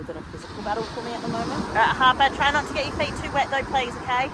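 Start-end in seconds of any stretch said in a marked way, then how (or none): 1.7-4.2 called out louder across the beach; calling out. Real, not acted. Mum calling to Harper in the shallows, raised voice. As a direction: call her back from the water.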